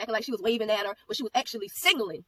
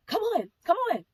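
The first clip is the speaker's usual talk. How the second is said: much higher, sing-song coaxing imitation of the stranger; mock coaxing lady; 10 semitones higher, 4 dB louder than usual. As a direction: mimic the odd woman luring the child, pitched way up.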